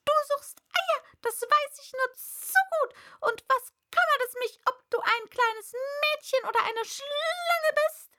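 high, loud, quick-fire bird tirade; indignant pigeon rant; scornful pigeon. A narrator's reading. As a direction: accuse her in an agitated pigeon voice. Plays the accusing pigeon.

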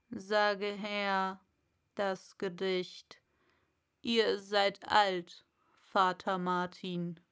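slow caterpillar; slow, commanding caterpillar; Raupe. Acted. Raupe voiced. (langsame, befehlende Raupe)

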